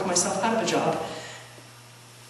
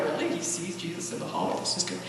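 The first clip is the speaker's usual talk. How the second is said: moved, hushed; 7 dB quieter than usual. Retold or imitated. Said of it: "get a little undone".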